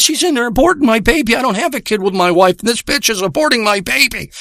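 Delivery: high, agitated insistence; panicked. Retold or imitated. Panicked friend insists in retold call.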